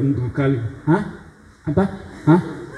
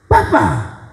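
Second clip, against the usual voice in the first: a raised call across the market; 6 semitones higher than usual. Retold or imitated. Preacher calling out to a deaf old seller. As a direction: call out to get his attention.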